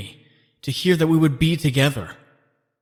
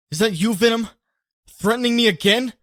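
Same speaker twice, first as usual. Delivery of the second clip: angry demand; 8 semitones higher than usual. Acted. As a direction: demand an answer, getting heated. Angry at phone threat.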